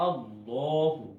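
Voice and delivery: আল্লাহু with a thick, full lam, drawn out slowly; heavy Allahu. Shown as a demonstration. Teacher showing students a heavy lam, slow and drawn out. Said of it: "একটু মোটা করে পড়তে হবে".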